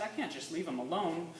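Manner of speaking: ordinary delivery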